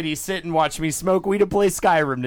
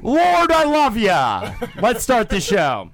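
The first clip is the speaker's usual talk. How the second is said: mock prayer; 6 semitones higher, slower than usual. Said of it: "I'm gonna do a prayer".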